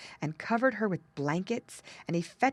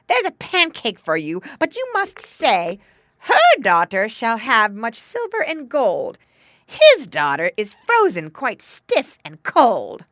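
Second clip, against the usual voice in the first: loud, high, bossy dictation; scolding; 5 semitones higher, 12 dB louder than usual. A narrator's reading. Stepmother bribing the dog with a pancake, loud scolding. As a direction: dictate the words forcefully at high volume. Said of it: "scolded the woman".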